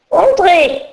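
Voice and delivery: high, loud sour bark from the teacher; strict teacher calling through the door, high and loud; sour shout. Acted. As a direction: snap it out like a sharp old schoolmistress. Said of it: "crie une voix aigre".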